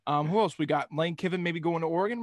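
normal talking voice

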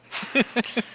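a quick, sheepish chuckle; short laugh. Real, not acted. Told by Joe to go ahead, a quick sheepish laugh. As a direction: laugh it off before answering. Friends politely deferring who speaks.

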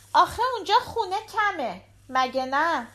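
put-on high-pitched little girl chatting to her dad; little daughter. A narrator's reading. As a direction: voice a young daughter, pitched well up. Narrator voices the daughter asking. (صدای زیر دختربچه)

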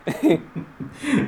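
short high laugh. Real, not acted. After blocking both accounts, short high laugh.

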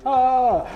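high, loud mock gasp "Ah!"; crocodile opening its mouth. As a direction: pop out a sudden high "Ah!" like a jaw snapping open.

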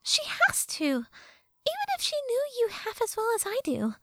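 high-pitched sassy fairy; Tattle fairy voice. Acted. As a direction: play a cheeky fairy girl, high.

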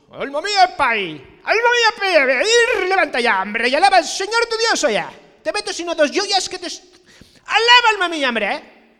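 pushy scolding voice. Pretend. Preacher scolding his soul to praise God, hammed-up shouting. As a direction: boss your own soul around like a loud relative. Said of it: "O le dices".

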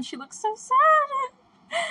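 high, drawn-out pitying wail; emotional. Real, not acted. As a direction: squeak it out, overcome with sympathy. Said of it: "I'm so emotional about this".

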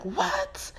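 squeaky high shocked "What?". Retold or imitated. Hearing her friend's breakup news, a high shocked yelp.

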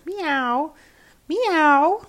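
high rising meows; cats crying meow. A narrator's reading. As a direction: mew like a procession of cats. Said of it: "they cried all together".

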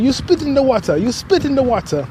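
a hint of Jamaican, high and scolding; lightly voiced Jamaican repeat; Jamaican accent. Jamaican worker repeating his accusation, light Jamaican accent. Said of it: "He was like".

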